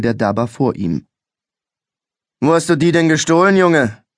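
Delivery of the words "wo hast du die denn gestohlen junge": higher, louder, quick stern demand; a policeman grilling a suspect, stern; stern police officer. A narrator's reading. Policeman demanding where the boy stole it, stern. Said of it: "verlangte er in einem strengen Tonfall".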